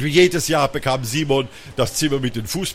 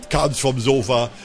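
ordinary delivery, nothing marked

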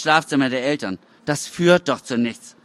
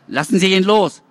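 louder, demanding shout; father shouting at the uniformed men; father's shout; 6 semitones higher, 5 dB louder than usual. A narrator's reading. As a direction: order them to let go.

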